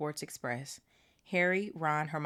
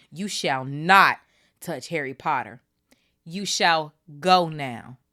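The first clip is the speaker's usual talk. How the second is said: louder, slow stern order; fierce command; 9 dB louder, slower than usual. Acted. Dobby commanding Malfoy to leave, loud and stern. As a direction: lay down the law. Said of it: "he said, fiercely".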